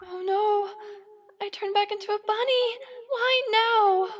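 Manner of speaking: loud, high alarmed outburst, hurried; panicked cry. Acted. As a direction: blurt it out in sudden panic. Turned back into bunny, panics.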